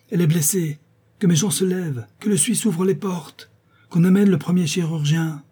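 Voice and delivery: the marquis calls servants for a surgeon; alarmed cry. Acted. Alarmed shouted orders. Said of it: "le marquis s'écria".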